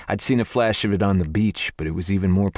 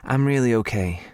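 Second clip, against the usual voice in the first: higher, fake-bright reassurance; forced cheerful protest; 6 semitones higher than usual. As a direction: brush it off with a put-on smile. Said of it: "she protested with the fakest smile".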